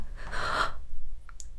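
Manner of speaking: sharp breathless gasp of dismay; shocked gasp. Acted. Young woman gasping as she reads her lover's farewell letter. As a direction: catch your breath in shock.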